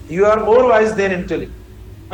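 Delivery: each word laid out very slowly for copying; slow dictation. Shown as a demonstration. Teacher dictating the corrected sentence. (धीमे-धीमे लिखवाना)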